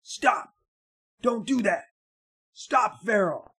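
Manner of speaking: loud panicked shout. Retold or imitated. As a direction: yell it out, alarmed. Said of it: "and it says".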